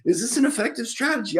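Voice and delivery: high, fast, disbelieving question; incredulous. Retold or imitated. Comedian mocking a baseball-game preacher, disbelieving. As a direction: scoff at the strategy in a high voice.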